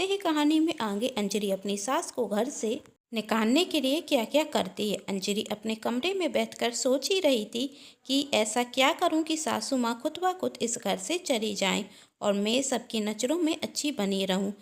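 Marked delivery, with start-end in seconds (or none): none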